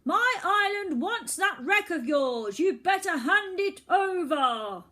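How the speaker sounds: high, loud, slow bellow; bellowed through megaphone. Acted. Boy bellows his demand through a megaphone, slow and loud. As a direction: boom it through a brass megaphone. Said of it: "bellowed through it".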